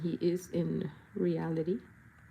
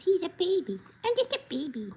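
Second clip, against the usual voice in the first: put-on baby voice; 9 semitones higher than usual.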